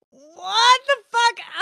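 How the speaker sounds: slow, high mock shout of disbelief; incredulous yell. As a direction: drag out a stunned what the fuck. Shocked at date's question.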